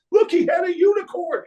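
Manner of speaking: excited parent. Retold or imitated. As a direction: squeal it out in delight. Parents freak out.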